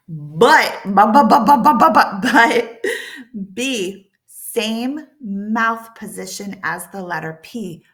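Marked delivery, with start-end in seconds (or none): 0.1-2.8 "but" repeated loud with a voiced b; voiced b drills. Shown as a demonstration. Teacher drilling the voiced b sound loudly. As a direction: punch out each "but" loudly, buzzing the b. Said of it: "we're going to make some noise".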